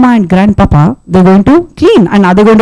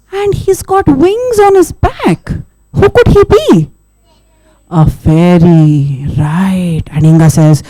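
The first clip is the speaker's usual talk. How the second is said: plain reading voice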